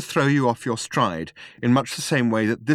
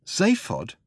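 higher startled exclamation of the name; exclaiming; 8 semitones higher than usual. A narrator's reading. Ford learns Zaphod chatted up Arthur's girl, exclaiming. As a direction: call out the name in disbelief. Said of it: "exclaimed Ford".

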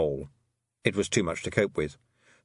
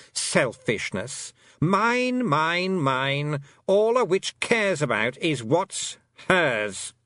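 high, sing-song mocking of mine mine mine; mocking fairy queen; 7 semitones higher, much slower than usual. A narrator's reading. A fairy queen mocking a witch's selfishness. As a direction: parody the girl's greed, slowly.

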